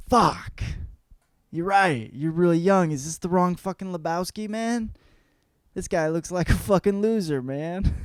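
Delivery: acted high-pitched frustration; exasperated realization. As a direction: blurt it as if you grabbed the wrong man. Brother realizes he has wrong man.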